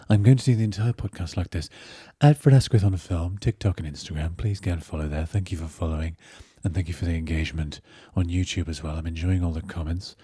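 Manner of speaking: low, hushed character voice; put-on podcast voice. Host jokingly opening the whole podcast in character, low soft put-on voice. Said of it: "do the whole podcast on this voice".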